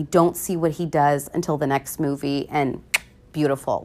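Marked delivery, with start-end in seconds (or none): none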